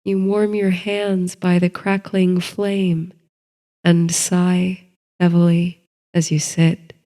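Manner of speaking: ordinary delivery, nothing marked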